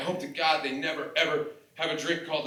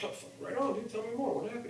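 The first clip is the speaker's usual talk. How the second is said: eager bro; 9 semitones higher, 6 dB quieter than usual. Retold or imitated. Eager reaction, retold himself.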